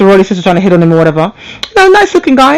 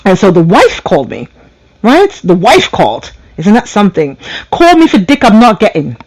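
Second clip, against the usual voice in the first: ordinary talk, nothing marked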